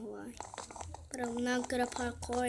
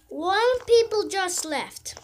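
exasperated complaint; 6 semitones higher, 10 dB louder, slower than usual. Real, not acted. Player left the match.

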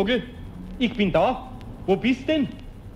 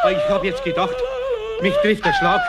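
exasperated relief. Acted. Eder relieved, startled.

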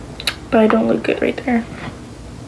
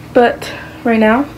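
ordinary talk

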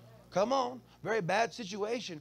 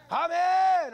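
loud, high shouted amen; yelled amen; 12 semitones higher, 7 dB louder than usual. Retold or imitated. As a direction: shout it out with joy. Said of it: "when he yelled out".

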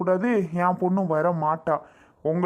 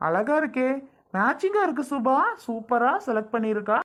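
higher, delighted compliments; fond praise; 5 semitones higher than usual. Acted. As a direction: gush over her saree.

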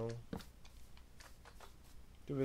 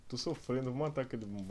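usual speaking voice

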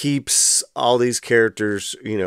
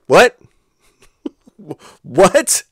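loud, much higher incredulous what; shocked disbelief; 8 semitones higher, 7 dB louder than usual. Real, not acted. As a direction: blurt it out in real disbelief, then laugh. Shocked by daughter threatening father.

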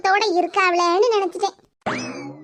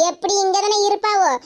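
normal talking voice

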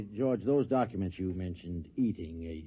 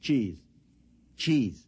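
raised voice into the phone; louder repeat; 3 semitones higher, 5 dB louder than usual. Acted. Mister Adams repeating his order louder down the phone. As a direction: say it again, louder, to be understood.

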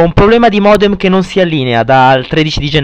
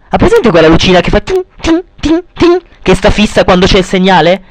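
imitating a blinking light; 2 semitones higher than usual. Mimics the modem light blinking.

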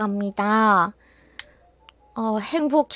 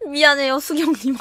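usual speaking voice

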